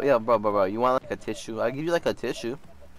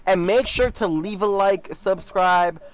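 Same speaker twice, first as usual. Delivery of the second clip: upbeat outro read to the viewers; chirpy sign-off pitch; youtuber outro; 5 semitones higher than usual. Acted. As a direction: sell the like and subscribe.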